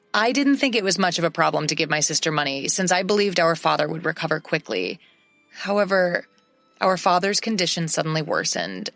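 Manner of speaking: ordinary delivery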